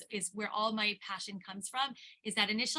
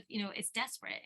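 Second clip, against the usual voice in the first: ordinary talk, nothing marked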